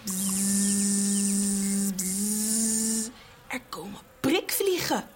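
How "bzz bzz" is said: buzzing fly sound. Acted. Flies arrive.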